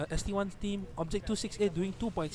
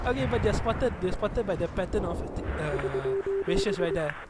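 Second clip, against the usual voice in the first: ordinary delivery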